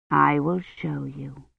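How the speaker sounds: low, slow, hushed storytelling; soft and drowsy promise; softly. A narrator's reading. Kivrin tells the crow's part to sleeping child, softly. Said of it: "Kivrin said softly".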